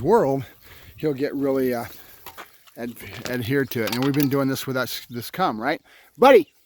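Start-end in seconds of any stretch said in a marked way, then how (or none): 6.2-6.5 loud, high call across the yard. Real, not acted. Trainer calling his dog across the yard, loud call. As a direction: call the dog's name from afar.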